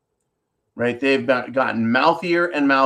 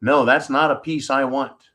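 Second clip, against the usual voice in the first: ordinary delivery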